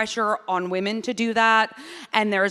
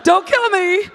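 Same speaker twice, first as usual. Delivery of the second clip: high, forced nervous agreement; nervous placating; 9 semitones higher, 5 dB louder, much slower than usual. Acted. Woman placating a creepy stranger, comically nervous. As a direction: humour him in a high, scared voice. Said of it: "you have to be like".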